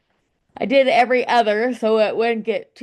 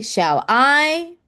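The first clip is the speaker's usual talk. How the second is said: light "shall", higher, drawn-out "I"; iamb stress; 5 semitones higher, 3 dB louder than usual. Shown as a demonstration. Teacher marking the first iamb, "shall I" with higher stress on "I". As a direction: lean hard on "I" in "shall I". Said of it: "This is each iamb".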